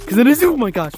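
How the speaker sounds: sudden startled yelp. Real, not acted. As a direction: caught off guard, voice jumps up. Monster suddenly chases him.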